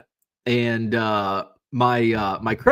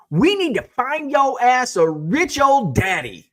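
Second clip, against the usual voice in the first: much higher, louder, slow mock of the mom; imitating the angry mom; 12 semitones higher, 5 dB louder, slower than usual. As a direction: ham up her scolding line. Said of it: "She's like".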